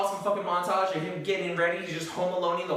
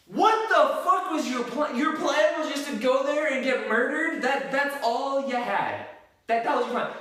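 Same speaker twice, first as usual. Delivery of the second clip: exasperated disbelief; 6 semitones higher, 3 dB louder than usual. Real, not acted. Mocking Rambo's stupid plan.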